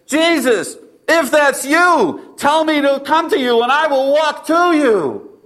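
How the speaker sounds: high, drawn-out call across water; impulsive yell. Retold or imitated. Disciple yelling to Jesus from the boat, impulsive yell. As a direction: call out high, stretching the words. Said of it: "I just yelled out".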